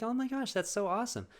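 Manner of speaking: high, gushing mock excitement; gushing mom. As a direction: coo it with big delight.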